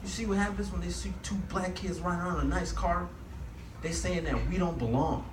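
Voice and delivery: mildly agitated complaint; mock freak-out. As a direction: imitate a friend flipping out, without shouting. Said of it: "he flipped out right away".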